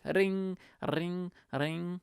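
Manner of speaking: high, trilled ringing; sing-out high telephone trill; phone ring imitation. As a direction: mimic an old phone's ring. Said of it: "So I'll be like".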